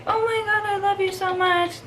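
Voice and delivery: mock overexcited dog owner cooing; gushing owner. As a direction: overdo the love like a doting owner. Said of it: "So no, like".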